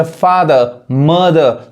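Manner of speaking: फादर and मदर ending "-duh", no r; silent r. Shown as a demonstration. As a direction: drop the final r of फादर and मदर. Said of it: "आर नहीं पढ़ाता है".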